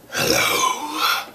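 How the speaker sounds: whispered, breathy hello looming overhead; unvoiced-sounding ominous whisper from a towering figure; low menace in a whisper; menacing stranger. Towering stranger speaks down at child.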